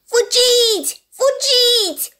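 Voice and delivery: high, loud, slow warning cry; a high, drawn-out alarm shout. Acted. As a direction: yell to run for your lives. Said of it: "strigă un pui de urs".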